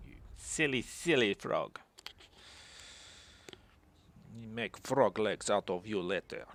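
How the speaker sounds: lightly done half-Russian accent, mocking; vague Russian accent. Player voicing a rival lord, mock Russian accent. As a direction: sneer at the frog in a sort-of Russian accent. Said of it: "what accent I'm trying to do there. It's almost like Russian".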